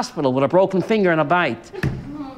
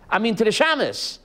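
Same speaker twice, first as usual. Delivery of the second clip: ordinary talk, nothing marked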